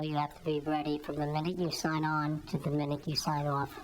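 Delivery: ordinary delivery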